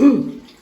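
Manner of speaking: very loud, high hiccup gasp; sharp hiccup squeak. Acted. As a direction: burst out a hiccup. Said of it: "get rid of these hiccups".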